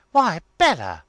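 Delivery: high, loud burst of astonishment; amazed exclamation. A narrator's reading. Lavinia eyeing her sister's bonnet, amazed exclamation. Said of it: "struck in with".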